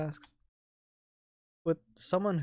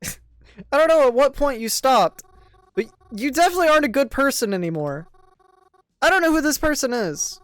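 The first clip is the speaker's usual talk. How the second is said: louder, bitter reproach; hurt confrontation; 9 semitones higher, 12 dB louder than usual. Acted. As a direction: tell him off, disappointed. Mary confronting Krovan.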